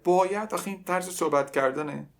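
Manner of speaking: ordinary delivery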